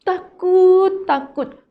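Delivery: high, loud frightened cry; afraid. Acted. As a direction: cry out the fear. Everyone afraid in storm. (seruan takut yang keras)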